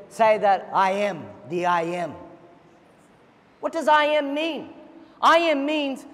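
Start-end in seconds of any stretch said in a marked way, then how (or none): 0.1-2.1 lower, slow and solemn; voice of God. Acted. God answering Moses at the burning bush, low and slow. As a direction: speak as God, weighty and unhurried.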